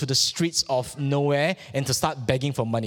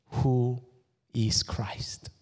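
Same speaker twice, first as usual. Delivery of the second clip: whisper; 3 semitones lower, much slower than usual. Acted. Preacher poses question to congregation.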